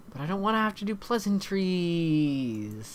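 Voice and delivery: slow, drawn-out whine; whiny protest. Acted. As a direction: whine like a reluctant kid, dragging the words. Dad whining to his daughter.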